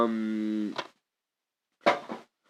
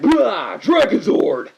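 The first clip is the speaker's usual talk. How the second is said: big hammed-up battle cry, higher and louder; mock TV shout; 9 semitones higher, 9 dB louder, much slower than usual. Mimicking the TV show's morph call, hammed-up shout. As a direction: yell it like a cheesy TV hero. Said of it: "the original T V show where, like".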